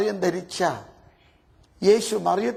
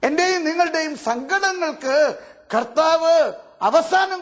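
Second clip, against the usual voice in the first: fervent preaching; 8 semitones higher than usual. Real, not acted. Preaching climax.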